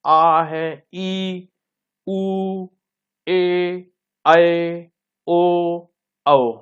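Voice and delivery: आ, ई through to औ each held long and level; drawn-out recital of आ, ई, ए, ऐ, ओ, औ; long vowel demonstration. Shown as a demonstration. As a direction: sustain every vowel from आ to औ, pausing between. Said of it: "दीर्घ स्वर कौन से हैं".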